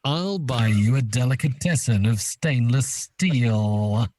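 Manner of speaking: hammed-up baddie offer; Bond villain. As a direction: stretch out the final word. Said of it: "as if he was a Bond villain".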